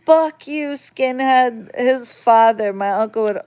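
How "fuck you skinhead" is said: put-on high, louder bratty kid taunt; kid's taunt. Imitating her bratty little cousin cursing her out, higher. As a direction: sneer it like a nasty little kid. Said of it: "That's what his thing was".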